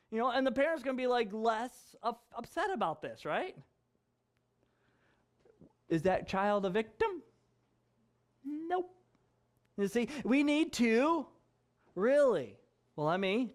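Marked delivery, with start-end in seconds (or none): none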